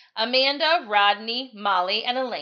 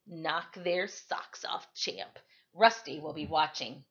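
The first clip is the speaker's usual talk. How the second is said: gentle encouragement; 5 semitones lower, 6 dB quieter, slower than usual. A narrator's reading. Kneels to encourage her gently.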